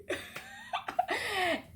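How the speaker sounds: a high burst of laughter. Real, not acted. Vlogger laughs at her visa complaint, high laugh. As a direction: laugh at your own joke.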